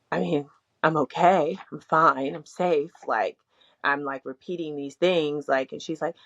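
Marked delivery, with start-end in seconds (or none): none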